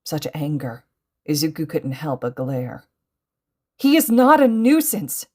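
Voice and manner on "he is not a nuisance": high, loud indignant protest; angry cry. A narrator's reading. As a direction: cry out in seething defence. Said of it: "Izuku cried, seething inside".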